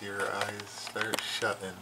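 usual speaking voice

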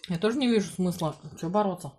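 talking with mouth full; speaking between bites; chewing food. Real, not acted. Seen 0: Woman chewing after spoonful at -2s; unsure which person speaks.

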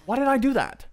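high, loud exasperated cry; real frustrated shout; exasperated yell. Real, not acted. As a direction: yell it at yourself. Self-anger at a mistake.

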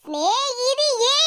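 சிநேகிதியே said very slowly, each syllable drawn; drawn-out teasing call. Acted. Daughter-in-law slowly teasing her mother-in-law with சிநேகிதியே. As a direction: stretch the word சிநேகிதியே out slowly and sweetly. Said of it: "யாத்தாடி ரெண்டு வாட்டி".

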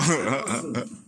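short burst of laughter; amused laugh. Real, not acted. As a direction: laugh at the echoed words. Laughs after echoing the clip.

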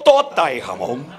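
low, slow mock-Chinese ordering; mock Chinese diner. Chinese diner gives the waiter his order, low slow mock-Chinese voice. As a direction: say the learned order low and drawn out.

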